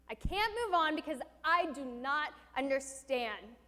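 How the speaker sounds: loud, high, pleading outcry; passionate cry. Retold or imitated. Preacher reliving his anger at God, loud pleading cry. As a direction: cry out desperately for answers. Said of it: "I felt like saying this".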